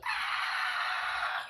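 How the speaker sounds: piercing high unvoiced scream; mock panicked screech; imitated mom shriek. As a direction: shriek like a dying bird. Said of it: "freaks out like a bird dying".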